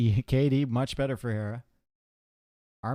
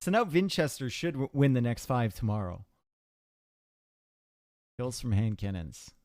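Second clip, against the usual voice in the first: usual speaking voice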